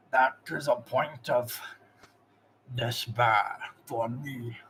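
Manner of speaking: slow, halting orc voice in pain; pained orc master. Acted. Zog confesses his weakness to the player, slow pained orc. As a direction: force out orc ways as if it hurts. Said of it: "almost like he's in pain when he says orc ways".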